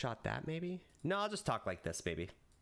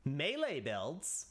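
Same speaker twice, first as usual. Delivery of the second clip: very slow, savoring each word; slow drawn-out reveal; 2 semitones higher, much slower than usual. Shown as a demonstration. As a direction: stretch "Melee builds" out for effect. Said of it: "I can be funny about this".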